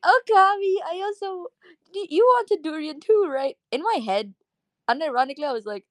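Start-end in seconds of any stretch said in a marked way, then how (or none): 0.0-3.6 high-pitched imitation of a disappointed friend; imitated pleading friend. Voices sad friend addressing the speaker.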